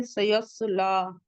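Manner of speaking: सयस्ला with a full-mouthed heavy sad; full-mouth heavy letter. Shown as a demonstration. Teacher shows the heavy letter in सयस्ला. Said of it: "पूरा mouth भर के पढ़ेंगे".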